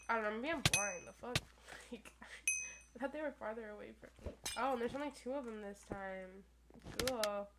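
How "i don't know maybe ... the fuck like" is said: normal talking voice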